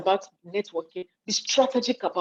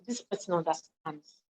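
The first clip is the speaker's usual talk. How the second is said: quiet; 3 semitones lower, 8 dB quieter than usual. Shown as a demonstration. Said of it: "I don't need to shout".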